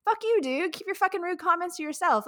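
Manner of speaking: high, loud, fast angry rant; ranting at a rude online hater, loud and fast; pissed off rant. Retold or imitated. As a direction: vent at the rude hater, fired up. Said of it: "I told Ryan, I was like".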